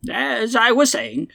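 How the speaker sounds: put-on high, slow elderly broadcaster; old-man radio personality. Old man resumes after being interrupted, slow high old-man radio voice. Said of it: "nineteen twenties radio personality with the old man accent".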